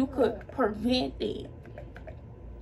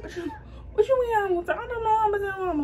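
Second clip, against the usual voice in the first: higher, fast, half-laughing disbelief; incredulous panic; 8 semitones higher, faster than usual. Retold or imitated. To her boyfriend about telling her mama, panicked disbelief. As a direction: she can't believe the question.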